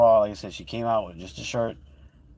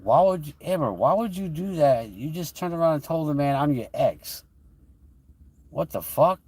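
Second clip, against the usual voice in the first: retold confrontation; 6 semitones higher than usual. Confronting Amber about the kiss.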